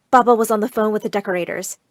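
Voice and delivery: plain reading voice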